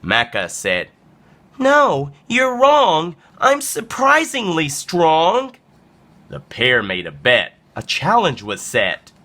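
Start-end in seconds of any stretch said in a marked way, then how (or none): 1.6-5.5 high-pitched, defiant small-animal voice; high storybook underdog retort; plucky little alpaca. Acted. Little alpaca answers the bully.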